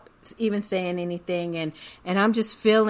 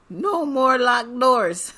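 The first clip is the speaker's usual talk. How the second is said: louder, higher, drawn out with laughter; quoted movie line; 5 semitones higher, 5 dB louder, slower than usual. Retold or imitated. Blurting a movie line at the motel, loud and laughing. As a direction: belt out the catchphrase, half laughing. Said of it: "I heard myself say".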